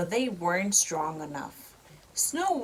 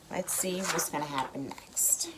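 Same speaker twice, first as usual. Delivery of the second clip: whisper; 4 semitones lower, 4 dB quieter than usual. Real, not acted. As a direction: lean in and whisper the suspense. Parent whispers to child.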